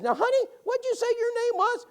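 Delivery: put-on high, sweet old woman's question; old lady voice. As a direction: mimic a kindly elderly lady, pitched high. Voicing Bettina asking wife.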